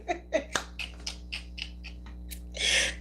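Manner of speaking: airy wheezing laugh; breathless silent laugh. Real, not acted. Streamer wheezing at her chat, silent laugh.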